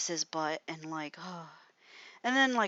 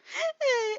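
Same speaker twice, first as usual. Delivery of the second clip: a sudden high-pitched laugh; burst of high laughter; 3 dB louder than usual. Real, not acted. Retelling a roleplay fight, breaking into real high laughter. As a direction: crack up laughing.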